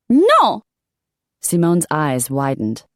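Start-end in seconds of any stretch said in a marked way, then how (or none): 0.1-0.6 much higher, louder shocked No; shocked cry. Acted. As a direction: gasp it out in disbelief. Simone shocked outcry.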